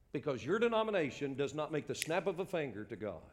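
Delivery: ordinary delivery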